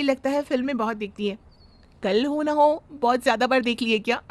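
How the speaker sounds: ordinary talk, nothing marked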